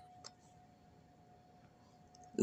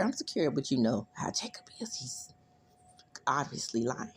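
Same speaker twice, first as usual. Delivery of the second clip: hushed, breathy confidential whisper; whispered aside; 3 semitones lower, 4 dB quieter than usual. Retold or imitated. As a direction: drop to a secret whisper.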